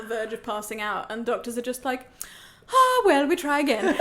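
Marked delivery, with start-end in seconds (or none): 2.7-3.9 much higher, louder mock casual doctor; breezy doctor. Patient mocking doctors who shrug off her pain, high breezy voice. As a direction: play the doctor as airily unbothered.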